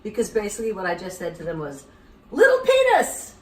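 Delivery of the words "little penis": high, loud, slow; mock emphatic. Shown as a demonstration. Telling what the toast meant, high and loud. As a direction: punch it out. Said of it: "That's what chin chin means".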